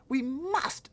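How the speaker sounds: put-on high loud girl's insistence; crying out. Sophie insisting to the giant, high loud cry. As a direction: demand it at full pitch. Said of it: "Sophie cried".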